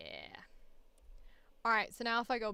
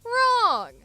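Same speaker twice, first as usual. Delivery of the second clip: sudden high shouted "Wrong!"; loud exclamation; 10 semitones higher, 9 dB louder than usual. Acted. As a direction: bark the word out loud and high. Announcer punchline exclamation.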